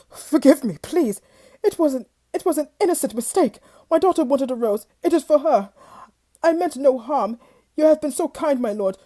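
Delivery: fast, high, loud desperate begging; panicked pleading. Acted. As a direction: babble for mercy. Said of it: "barely able to control his voice".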